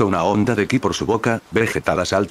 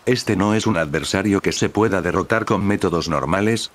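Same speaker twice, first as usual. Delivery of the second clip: ordinary delivery, nothing marked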